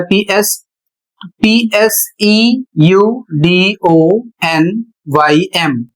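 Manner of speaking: letters spelled one by one, very slow; spelled out slowly. Shown as a demonstration. Teacher spelling the word slowly for students. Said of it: "अगला शब्द है PS".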